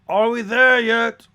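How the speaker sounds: loud, high, drawn-out call; impatient shout. Acted. Impatient passenger calls to the carriage driver, loud and high. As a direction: holler it slowly up to the driver.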